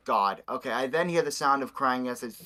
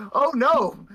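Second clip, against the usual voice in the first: sudden loud high cry; a mother's panicked shout; cried out; 9 dB louder than usual. Acted. Chili hears her kids scream, loud high cry. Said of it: "Chili cried out".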